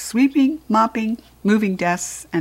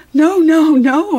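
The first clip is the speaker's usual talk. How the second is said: high, loud frightened hamster cries; squeaky panicked no; 9 semitones higher, 8 dB louder, slower than usual. A narrator's reading. Hamster begging the janitor not to turn off lights, loud and high. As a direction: cry out small and scared, high. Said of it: "I squeaked".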